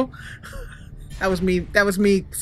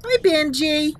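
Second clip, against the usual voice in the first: high-pitched cooing hello; cutesy greeting; 9 semitones higher, 3 dB louder, slower than usual. Pretend. Player greeting a cute soldier on screen, cutesy cooing. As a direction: coo it like greeting someone adorable.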